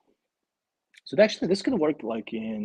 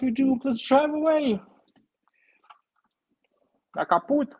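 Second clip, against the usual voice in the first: much higher, hammed-up German-accented soldier; mock German voice; 13 semitones higher than usual. Acting out the toy battle, mock German accent. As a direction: play a panicked cartoon German tank crew. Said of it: "I'm trying to think of a German voice".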